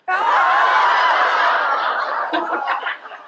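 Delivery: long high-pitched scream; reenacted scream. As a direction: scream in mock panic at the wasp. Wasp goes into her pants.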